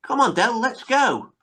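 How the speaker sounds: high eager call; imitating Rodney. Rodney urging Del to leave, high and eager. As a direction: pitch it up like an eager sidekick. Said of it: "He goes".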